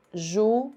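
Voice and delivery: "Júri" with the JU pushed hard; "Júri" stressed JU-ri with a rise; stress on first syllable. Shown as a demonstration. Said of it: "Tem a penúltima sílaba mais forte".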